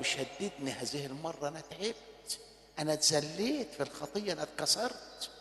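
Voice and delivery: soft, low, broken-hearted plea; weary prayer. Acted. Preacher voicing a tired sinner's prayer to God, soft and weary. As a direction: pray it quietly like an exhausted son.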